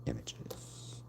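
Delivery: under-the-breath whisper; whispered to self. Real, not acted. Streamer whispering to herself while choosing images. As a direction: mutter it to yourself.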